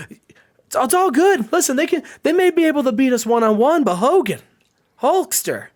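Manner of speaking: rally a doubting friend; earnest pep talk. Acted. Chase reassuring his tag team partner Hulk, urgent.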